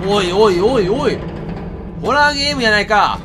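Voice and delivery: loud protest. Real, not acted. Gamer loudly protesting that it is a horror game.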